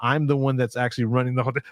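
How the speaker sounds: ordinary talk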